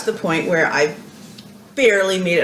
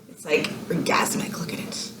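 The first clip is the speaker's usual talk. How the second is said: breathless whisper of awe; 3 semitones lower, 9 dB quieter than usual. Real, not acted. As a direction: hush it like a treasure. Gushing over yarn, voice drops.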